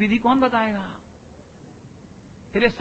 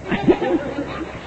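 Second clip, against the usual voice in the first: mock proud laugh; 5 semitones higher, 6 dB quieter than usual.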